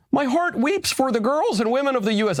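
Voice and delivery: mock prime minister in a silly high voice; mock Mette Frederiksen. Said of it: "a Danish impression".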